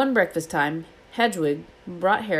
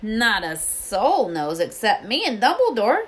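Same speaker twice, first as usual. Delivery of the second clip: slow, higher, emphatic boast; proud; 5 semitones higher, 4 dB louder than usual. A narrator's reading. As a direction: declare it proudly as Hagrid. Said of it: "said Hagrid proudly".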